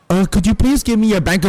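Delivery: loud, high imitation of a caller swallowing the mic; mock a phone agent right on the microphone; mic in mouth. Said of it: "like she's got her microphone in her mouth".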